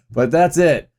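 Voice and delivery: ordinary delivery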